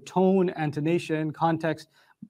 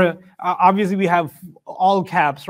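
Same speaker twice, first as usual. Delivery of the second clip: usual speaking voice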